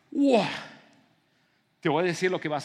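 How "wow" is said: high, loud burst of admiration; mock starstruck exclamation; awestruck wow. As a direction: react like an awestruck fan. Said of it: "La persona que tú tienes así como".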